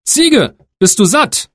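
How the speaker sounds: high, bright calling question to the goat; eldest son asking. Acted. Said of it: "fragte er".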